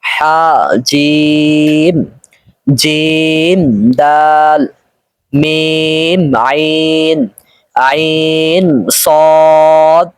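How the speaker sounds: very slow, each letter name stretched; drawn-out letters. Shown as a demonstration. Teacher stretching letter names for students. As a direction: hold every vowel long and even. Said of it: "টেনে টেনে পড়ে শোনাচ্ছি".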